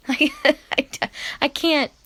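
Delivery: choked-up nervous laugh; too upset to finish the sentence; getting emotional. Real, not acted. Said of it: "I'm getting a bit emotional again".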